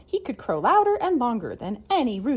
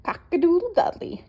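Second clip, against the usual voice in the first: mocking whisper; 9 semitones higher, 3 dB louder than usual. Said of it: "he scoffed".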